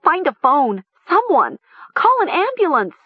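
very high, frantic cries; panicked high-pitched calls; wild shrieking. A narrator's reading. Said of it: "Mara shrieked wildly".

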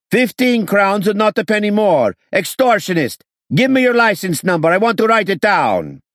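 high, loud indignant yelling; angry shouting. A narrator's reading. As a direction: shout him down as extortionist. Haggling passenger shouting back.

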